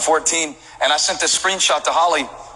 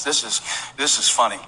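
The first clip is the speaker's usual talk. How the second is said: ordinary talk, nothing marked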